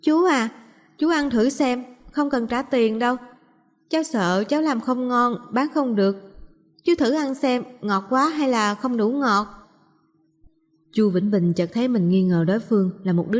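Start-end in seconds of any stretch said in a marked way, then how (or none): 0.0-9.5 put-on higher-pitched child's voice, gently urging; high, polite young girl asking for a taste; little girl coaxing. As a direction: coax a grown-up like a small girl.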